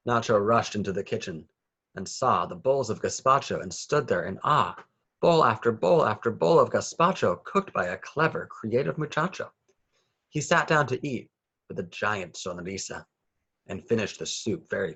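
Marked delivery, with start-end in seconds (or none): none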